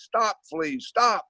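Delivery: high pleading cry to the fleas; a lightly acted boy calling out to the fleas; boy yelling at fleas.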